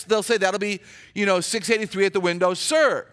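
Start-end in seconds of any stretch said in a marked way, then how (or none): none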